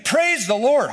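urging the horse on; excited call. Retold or imitated. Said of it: "Says it again".

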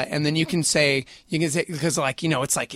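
ordinary delivery

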